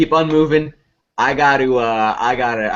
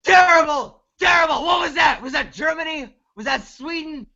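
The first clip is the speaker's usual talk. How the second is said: high-pitched, outraged scolding; scathing teacher; 9 semitones higher than usual. Acted. Teacher rejecting a student's bad accent, scathing and outraged.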